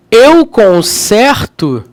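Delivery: CONSERTO with an open stressed E, as if "consérto"; slow, deliberate conserto with a wide-open E; E aberto. Shown as a demonstration. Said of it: "tem um acento agudo". (conserto com E aberto, devagar)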